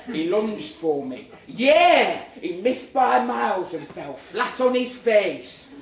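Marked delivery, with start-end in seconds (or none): 1.5-2.2 much higher, louder yell; hammed-up triumphant yell in the mirror; mock battle cry. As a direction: shout it like you're winning the fight. Imaginary lunge at rival.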